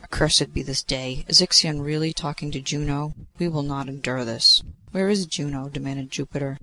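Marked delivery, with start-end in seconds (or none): none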